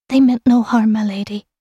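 put-on high, whispery servant girl; timid stage whisper trailing into breath. A narrator's reading. Frightened maid excuses her abusers, whispering. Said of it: "Kel heard a whisper".

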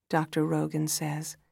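usual speaking voice

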